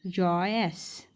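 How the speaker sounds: Joyce drawn out very slowly; teacher's slow demo of Joyce; slow model word. Shown as a demonstration. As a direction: say Joyce slowly so students catch it. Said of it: "देखो".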